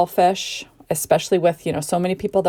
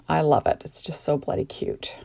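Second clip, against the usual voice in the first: soft unvoiced gushing whisper; 2 semitones lower, 5 dB quieter than usual. Real, not acted. Crafter whispering fondly over her cute mushrooms. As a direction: breathe it out like a delighted secret.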